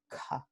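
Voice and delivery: "K" with a puff of air; aspirada. Shown as a demonstration. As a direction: release "K" with extra breath. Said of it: "con aspiración". ("K" aspirada, con soplo de aire)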